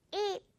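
"eat" with a long spread-lip ee; smile vowel. Shown as a demonstration. Teacher demonstrating the smile sound on "eat". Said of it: "eat 이렇게 발음을 해달라는 거죠".

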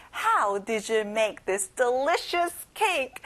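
very slow, stressed How; slow model sentence. Shown as a demonstration. Teacher models the last sentence very slowly. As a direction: stretch it out word by word. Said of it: "our last sentence".

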